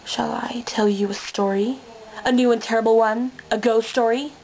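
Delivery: ordinary talk, nothing marked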